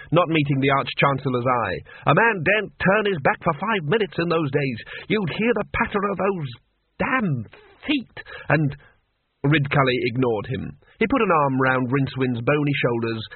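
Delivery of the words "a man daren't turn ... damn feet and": higher-pitched, aggrieved grumbling; defensive muttering. Acted. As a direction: grumble on, breaking up the last words. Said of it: "muttered the Dean".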